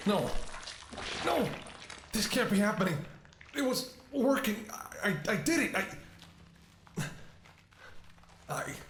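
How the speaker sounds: watching it all fall apart; panicked despair. Acted. Cure suddenly failing before her.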